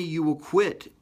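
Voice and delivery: ordinary delivery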